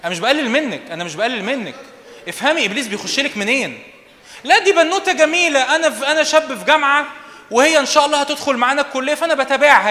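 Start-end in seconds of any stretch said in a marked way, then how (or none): 4.4-9.9 high, loud mock student excuse; mock young man. Preacher imitates a university boy justifying himself, high and loud. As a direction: ham up the boy's innocent excuse.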